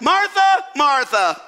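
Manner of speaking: double-dinged name. Acted. Jesus answers Martha's complaint, double-dinging her name. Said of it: "Notice Jesus double dinged her".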